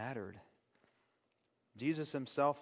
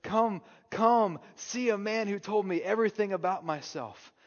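excited call; 5 semitones higher, 5 dB louder than usual. Retold or imitated. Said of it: "she goes into her town and says".

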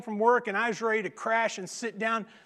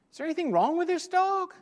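higher, fast worried woman's voice; buyer's voice; 10 semitones higher, faster than usual. Retold or imitated. Preacher imitating the dog's buyer on the phone, higher and fast. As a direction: voice the anxious buyer.

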